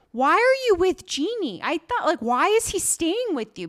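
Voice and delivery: high, loud mock disbelief; incredulous questioning. Minji seeing her with Genie, retold as loud incredulity.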